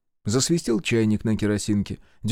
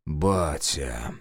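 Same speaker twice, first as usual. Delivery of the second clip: глухая злоба; 7 semitones lower, 4 dB quieter than usual. A narrator's reading. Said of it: "с глухой злобой прошептал".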